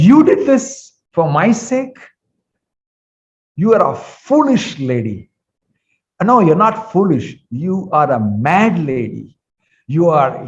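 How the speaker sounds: going wild. Acted. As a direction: lash out at a foolish mother. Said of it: "he goes completely wild after that".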